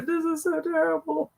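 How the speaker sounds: hammed-up weepy lament; mock crying. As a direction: whimper it like a pretend sob. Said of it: "like, hugging each other".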